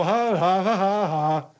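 drawn-out mock ha-ha; imitated singsong laugh. General questioning Bud's laugh, singsong imitation. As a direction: mimic a laugh like a song. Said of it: "come out like a song".

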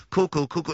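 chirpy cuckoo call, two notes; cuckoo clock. Acted. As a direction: imitate a cuckoo clock chiming. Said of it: "a little cuckoo clock impression".

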